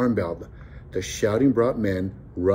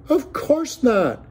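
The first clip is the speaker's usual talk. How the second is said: high, slow mock-incredulous scoff; slower than usual. A narrator's reading. Narrator scoffing that the bandit could read, high and drawn out. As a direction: scoff at the very idea. Scornful answer to rhetorical question.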